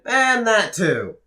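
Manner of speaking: high, loud, drawn-out cry; triumphant shout. Acted. Wrestler finishing a rebel speech, triumphant shout. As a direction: cap the rant with a big, slow yell.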